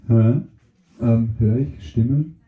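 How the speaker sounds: very low, dopey mushroom voice. Acted.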